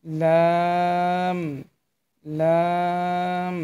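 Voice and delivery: লাম drawn out long; teacher modelling লাম slowly; slow letter demo. Shown as a demonstration. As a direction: stretch লাম slowly for learners. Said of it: "এই হরফটি কি?".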